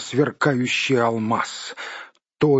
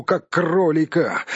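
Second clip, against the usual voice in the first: ordinary delivery, nothing marked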